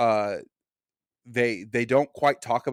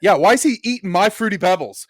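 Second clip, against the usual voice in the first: put-on high, louder outraged kid; indignant teen; 8 semitones higher, 8 dB louder than usual. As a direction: complain like a wronged kid. Acted kid confronting mom.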